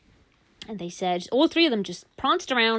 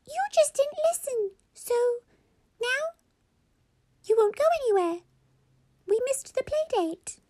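very high-pitched squeaky mum; squeaky toy voice. Acted. Mummy answers Baba about lunch, squeaky voice. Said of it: "albeit in a very squeaky tone".

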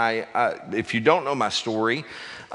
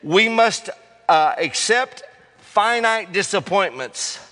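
slow, higher, louder oratory; quoting King; 7 semitones higher, 3 dB louder, slower than usual. Retold or imitated. Preacher quoting Martin Luther King, slow and grand. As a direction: declaim it like a civil rights speech. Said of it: "He said".

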